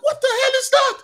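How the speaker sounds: sudden high startled cry. Retold or imitated. Worker hears a blast at the machine, startled high cry. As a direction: jump at the noise and blurt it out.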